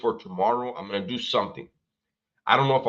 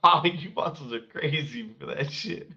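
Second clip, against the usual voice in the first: ordinary delivery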